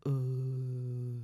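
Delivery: soft low dopey ooh; dumb admiring ooh. Retold or imitated. Describing her ideal man's noise, a soft low ooh. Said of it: "the noise is like".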